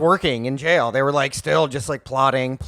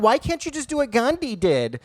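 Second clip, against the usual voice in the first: whiny mock question; 7 semitones higher than usual. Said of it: "people are like".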